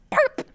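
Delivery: high, loud sudden peep; deafening chirp. Acted. Sudden sound of another bird arriving, loud high chirp. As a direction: burst out with a piercing bird chirp. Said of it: "there was a deafening sound".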